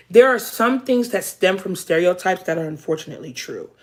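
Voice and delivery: ordinary talk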